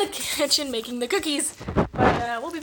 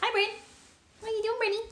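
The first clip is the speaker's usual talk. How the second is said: pet talk; 9 semitones higher, 5 dB quieter, faster than usual. Real, not acted. Owner cooing at her dog Brain, high baby talk. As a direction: coo at the puppy.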